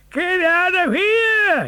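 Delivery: very high, loud, slow furious yell; fisherman's yell. A narrator's reading. Fisherman chasing the hungry dog away, furious yell. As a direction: bellow at the dog to get out. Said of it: "yelled the fisherman".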